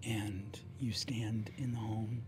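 game master narrating an empty home softly, wistful; hushed story narration. A narrator's reading. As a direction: let the empty house fall silent. GM's quiet closing narration.

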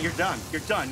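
low, soft verdict; quiet resignation. Real, not acted. Gamer telling himself it is over, quietly resigned. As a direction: say it low, under your breath.

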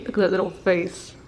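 normal talking voice

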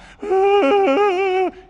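high wailing child's gasp, hammed up; mock whiny gasp. Parent imitating a tired crying kid's wail. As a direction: sob out a fake toddler wail. Kid crying over nothing, acted.

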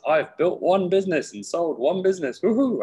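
high, loud, mock triumphant whoop; naive cheer. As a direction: play your cocky younger self. Mocking his naive younger self.

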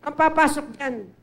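mock-angry shout at a trespasser; imitating the furious neighbor again; retold angry yell. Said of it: "galit na galit".

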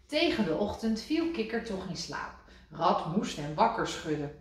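usual speaking voice

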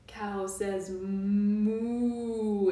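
long slow mooing; teacher mooing slowly for children; cow moo. Shown as a demonstration. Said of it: "What does a cow say?".

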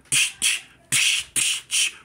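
voiceless bursts of shattering glass; imitated breaking window. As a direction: mimic a window being shot out. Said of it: "you hear that window".

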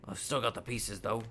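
ordinary delivery, nothing marked

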